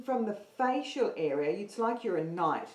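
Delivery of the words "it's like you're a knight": ordinary talk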